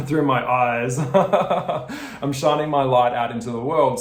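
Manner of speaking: giggling while you say it; laughing. Real, not acted. Laughing at own lighthouse image.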